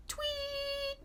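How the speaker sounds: high whistled tweet; birdsong imitation. A narrator's reading. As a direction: chirp it like a chickadee.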